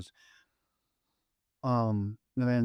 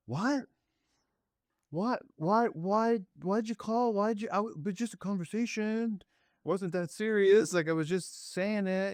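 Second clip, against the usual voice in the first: much higher panicky protest; panicked son; 10 semitones higher than usual. Retold or imitated. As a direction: squeak in disbelief. Son panicking, imitated high.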